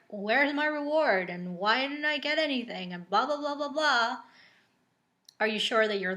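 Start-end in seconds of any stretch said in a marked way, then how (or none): 0.1-4.2 sing-song mock grumble, pitch swooping up; mock complaining. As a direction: imitate a whiny demand, trailing into blah-blah. Mimicking a complaining listener's imagined quote.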